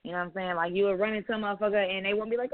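ordinary delivery

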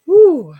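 a sudden high, loud whoop to shake off the heaviness; loud woo. Real, not acted. After finishing the heavy story, she lets out a loud high woo. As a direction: let out a big releasing woo.

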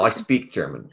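ordinary delivery

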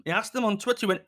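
normal talking voice

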